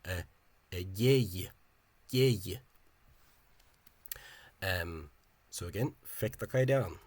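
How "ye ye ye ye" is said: "ye, ye" sounded out loudly as two syllables; disyllabic pronunciation demo. Shown as a demonstration. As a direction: model "ye, ye" emphatically, each syllable distinct. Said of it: "disyllabic two-syllable pronunciation survived".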